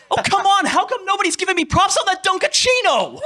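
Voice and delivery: high shouted anime complaint with the joke word; ad-libbed dub line. Retold or imitated. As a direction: yell "Dunkachino" as the sulking player. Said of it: "Can I have one wild?".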